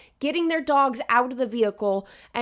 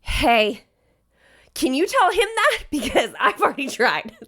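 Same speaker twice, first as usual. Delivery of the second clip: laughing plea; 3 dB louder than usual. Retold or imitated. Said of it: "pleading with the driver".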